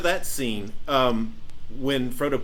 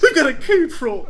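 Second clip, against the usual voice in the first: higher, louder, laughing through it; casual laughing quip; 10 semitones higher, 6 dB louder than usual. Retold or imitated. Friend imitating Boromir's wry understatement, laughing. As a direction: toss it off wryly with a laugh. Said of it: "casually, almost humorously says".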